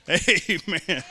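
chuckling amen; amused amen; laughing. Real, not acted. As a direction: laugh through the word. Laughing at own joke.

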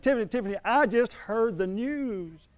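high, slow, eager calling of her name; high, drawn-out excited call. Retold or imitated. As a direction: burst in thrilled to share news. Bursting in with good news.

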